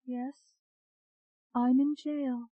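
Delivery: high, hollow trance voice; hollow, distant. A narrator's reading. Hypnotized patient answering the doctor, hollow and distant. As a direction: answer as though from far away, pitched well up. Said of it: "Her voice sounded hollow".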